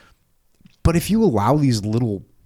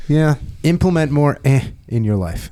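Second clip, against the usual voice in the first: normal talking voice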